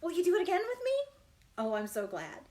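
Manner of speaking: ordinary talk, nothing marked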